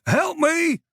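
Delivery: panicked high shout; shouting for help. A narrator's reading. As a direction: yell up from the cellar, trapped. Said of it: "Mason shouted from below".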